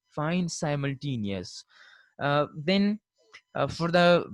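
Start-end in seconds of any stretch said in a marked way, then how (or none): 0.5-1.6 Simultaneous as "sigh-multaneous", softer; American pronunciation. Shown as a demonstration. Teacher repeating after "sigh", Simultaneous as "sigh-multaneous". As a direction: eye diphthong opening Simultaneous.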